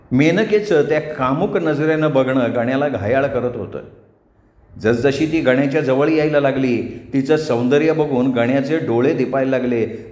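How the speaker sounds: usual speaking voice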